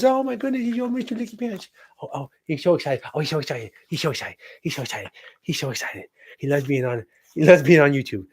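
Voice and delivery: high-pitched, repetitive cooing to a dog. Real, not acted. Owner showing off his excited dog on YouTube, gushing baby talk. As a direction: gush over your excited little pup.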